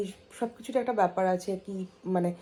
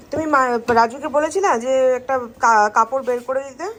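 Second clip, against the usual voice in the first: calling out; 4 semitones higher, 7 dB louder than usual. Real, not acted. Calling to family across room.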